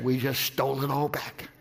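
hushed reveal. Retold or imitated. Banker quietly tells preacher money recovered. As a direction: savor the secret win quietly.

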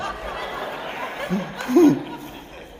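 a high, airless burst of laughter; high breathy laugh. Real, not acted. Pastor laughing at his own cussing joke, high and breathy.

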